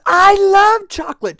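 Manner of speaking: high, loud squeal of delight; over-the-top excited outburst; gushing princess. Acted. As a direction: belt it out like a chocolate-mad little princess. Princess gushes about chocolate.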